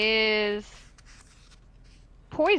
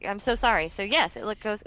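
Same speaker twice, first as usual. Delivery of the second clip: ordinary talk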